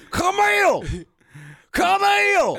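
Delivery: very high, loud drawn-out holler; screaming at his wife. Acted. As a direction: bellow the name up the stairs, hammed-up. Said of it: "screaming at his wife".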